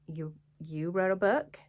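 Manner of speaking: lightly mocking question, rising at the end; snarky disbelief. People questioning the author, lightly snarky. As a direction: imitate a mildly snarky doubter. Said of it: "this little snarky attitude from people".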